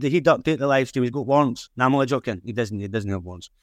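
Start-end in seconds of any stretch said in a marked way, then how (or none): 2.4-3.6 laughing it off. Real, not acted. Joking that a guest has warrants.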